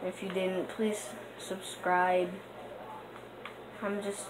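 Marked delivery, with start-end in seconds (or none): 0.7-2.3 slow, stretched please subscribe; begging slowly for subscribers; pleading long and slow; drawn-out plea. Real, not acted. Asking viewers to subscribe.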